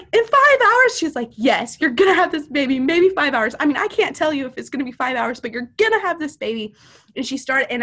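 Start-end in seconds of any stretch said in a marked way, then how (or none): 0.0-1.0 high, loud retold question; desperate outcry. Retold disbelieving cry in labor. 1.3-6.7 insistent reassurance. Retold or imitated. Friend insistently reassures her, retold.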